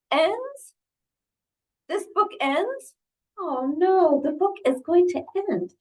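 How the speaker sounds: high, alarmed storybook voice; distressed Elephant. Acted. Elephant learns the book will end, distressed. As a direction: question it in high, dramatic dismay. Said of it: "He seems quite distressed".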